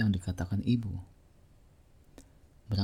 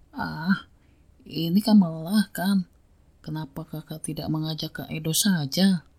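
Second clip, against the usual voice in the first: higher whiny little brother; whiny little boy; 7 semitones higher than usual. Acted. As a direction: complain like a spoiled kid. Lazy little brother complains.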